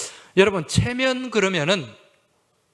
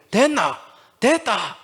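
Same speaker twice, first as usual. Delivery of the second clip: short, punchy Gyeongsang dialect, higher and louder; blunt Gyeongsang accent; 6 semitones higher, 3 dB louder, slower than usual. Retold or imitated. Pastor imitating a blunt Gyeongsang man, punchy Gyeongsang accent. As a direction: bark it out straight like a Gyeongsang man. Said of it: "굉장히 직선적이잖아요".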